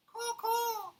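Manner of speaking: high cawing bird call; raven caw. Acted. Raven answering Paddington, high caws. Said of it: "said the raven".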